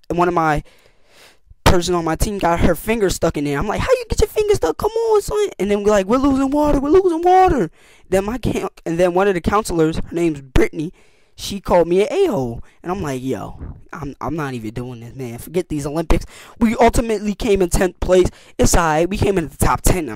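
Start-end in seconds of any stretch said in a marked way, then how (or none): 3.8-7.7 much higher, panicky urging; frantic. Retold or imitated. Finger stuck, water leaking. 13.2-16.2 much softer, giving up; a quiet, beaten "forget it"; fed-up mutter. Retold or imitated. Called an a-hole, quits.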